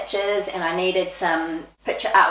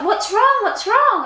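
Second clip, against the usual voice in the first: higher, louder alarmed asking; worried husband; 14 semitones higher, 4 dB louder, slower than usual. Retold or imitated. Husband asking his crying wife, mock alarmed concern. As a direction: imitate a startled husband checking on her. Husband reacts to her tears.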